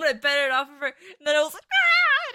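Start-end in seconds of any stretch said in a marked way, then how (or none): 1.6-2.3 high, loud retold scream; piercing mock scream of fright; re-enacted scream. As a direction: let out a short shriek for the story. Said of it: "and I screamed".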